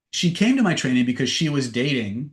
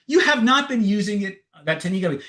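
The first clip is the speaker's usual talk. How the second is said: high, mock-indignant denial; indignant arguing; 7 semitones higher than usual. Girlfriend arguing with boyfriend about the technique, indignant. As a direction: act out a heated contradiction. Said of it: "she argued with him".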